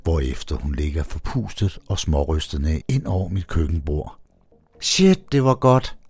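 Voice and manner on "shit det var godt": high, loud spent exclamation; high female voice exclaiming. A narrator's reading. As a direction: burst out, still breathless. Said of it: "udbryder hun".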